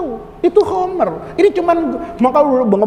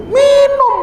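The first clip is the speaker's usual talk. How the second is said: mock-casual high reply; 13 semitones higher, 4 dB louder than usual. Guru answers with shocking reply.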